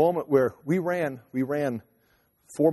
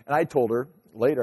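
ordinary talk, nothing marked

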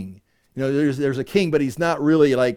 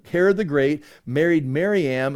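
normal talking voice

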